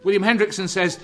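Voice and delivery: ordinary talk, nothing marked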